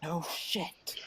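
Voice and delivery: hushed, breath-only aside; whisper. Acted. Friend answering a companion's awkward admission, whisper. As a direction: whisper it back. Secretive aside between characters.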